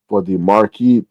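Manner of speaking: "market" said as "mar-keet"; playful mispronunciation. Pretend. Playful odd pronunciation of market.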